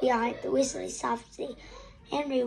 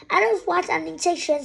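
mild scream; 5 semitones higher, 5 dB louder than usual. A narrator's reading. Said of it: "screamed Henry".

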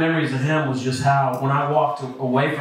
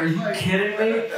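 incredulous; 7 semitones higher than usual. Retold or imitated. Reacting to girlfriend's complaint.

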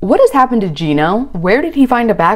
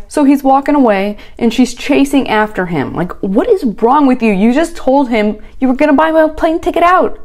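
ordinary delivery

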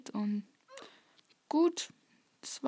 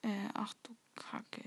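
whispering; 10 dB quieter than usual. Real, not acted. Whispered curse.

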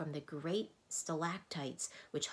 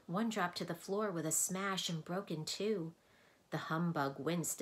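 ordinary delivery, nothing marked

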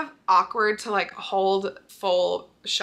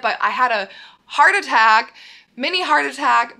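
excited; 3 semitones higher, 7 dB louder than usual. Real, not acted. Telling the near loss of her phone.

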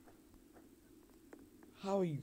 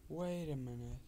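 ordinary delivery

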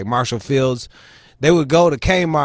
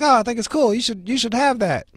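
high, sing-song impression of the sister, lightly done; imitating sister; 11 semitones higher than usual. Sister pushing her gift on him, mock high-pitched voice. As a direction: play the clueless sister, voice pitched up. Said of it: "She's like".

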